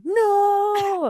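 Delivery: high mock wail of no; mock scream. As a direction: wail no like a heartbroken guy. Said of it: "he started screaming, going".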